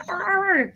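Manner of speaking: high mocking arr of rage; high mock angry growl. Mocking the abusive driver's yell with a high arr. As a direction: squeak out a fake furious arr. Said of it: "they go".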